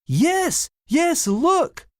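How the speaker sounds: high, loud astonished cry. A narrator's reading. Billy shouting to his brother, astonished. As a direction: gasp it out in wide-eyed shock.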